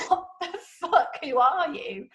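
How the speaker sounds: mock shocked, laughing through it; shocked outburst. Son outraged at being told his father murdered his mother, laughing. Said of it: "And he's like".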